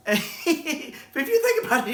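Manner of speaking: laughing high through the words; slow, giggling aside; words bubbling out on a laugh. Real, not acted.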